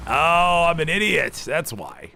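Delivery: high, loud self-mocking cry; a drawn-out dawning "oh", kicking yourself; exasperated realization. Real, not acted. Commentator realizing his own Catalan mix-up, exasperated at himself.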